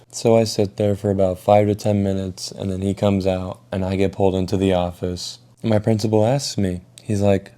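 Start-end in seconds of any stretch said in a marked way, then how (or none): none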